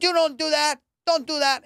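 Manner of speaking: very high, loud mock squabble; a couple fighting on 90 Day Fiance, high and loud; reality show fight. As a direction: shriek like a reality TV argument.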